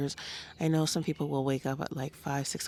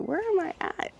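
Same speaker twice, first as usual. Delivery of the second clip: confused; 3 dB louder than usual. Real, not acted. Confused about the time, early morning.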